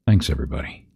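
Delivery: ordinary delivery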